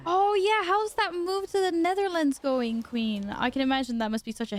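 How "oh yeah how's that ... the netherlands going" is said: excited. Real, not acted. Greeting a returning friend brightly.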